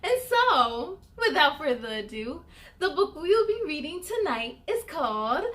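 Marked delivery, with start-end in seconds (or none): 2.8-5.4 teasing announcer build; building excitement. Acted. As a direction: build up to a shivery Brrrr. Dramatic title build.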